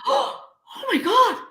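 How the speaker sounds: high, gasped retold horror; scared gasp. Mother hearing her son scream for help, horrified. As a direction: gasp it, voice leaping up in fright. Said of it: "it was so scary".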